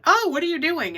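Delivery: high, loud startled question; startled cry. Retold or imitated. Mom startled by a stranger opening the car door, loud high alarm. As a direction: jump in fright and call out. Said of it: "My mom went".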